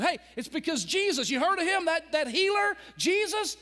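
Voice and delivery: high, loud excited telling; thrilled to share the news; excited passer-by. Acted. Passer-by tells the blind man Jesus is coming, excited. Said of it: "Somebody walking by said".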